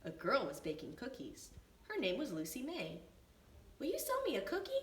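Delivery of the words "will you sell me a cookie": put-on high little-boy voice; young Benny. A narrator's reading. Benny asks Lucy May for a cookie, high and polite. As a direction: ask politely like a small boy. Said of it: "asked Benny".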